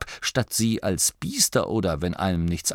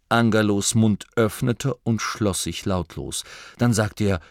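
plain reading voice

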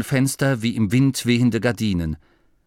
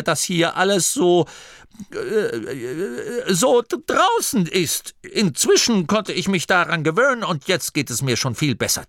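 much higher shrill denial, stammering on draußen; blustering abbot; 7 semitones higher than usual. Acted. The abbot insists to Masklin he is not afraid, high and stammering. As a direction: puff up and deny being scared.

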